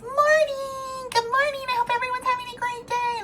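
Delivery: high, chirpy mock morning greeting; chirpy vlogger. Mocking cheery vloggers who greet fans brightly. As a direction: parody a relentlessly cheerful vlogger.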